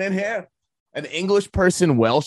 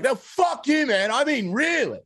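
much higher, hammed-up rant; mock outburst; 9 semitones higher, slower than usual. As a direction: play the actor losing it on set. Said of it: "He's like".

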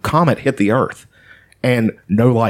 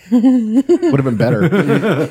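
laughing; 8 semitones higher, much slower than usual. Real, not acted. Laughing at a joke.

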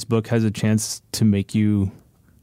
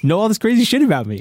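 much higher, louder imagined panic; mock alarm; 11 semitones higher, 5 dB louder than usual. Imagining the husband fretting over a reader, mock alarm. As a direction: play up the worry in a raised voice.

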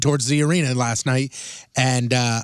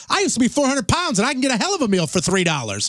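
retold indignant retort; 7 semitones higher than usual.

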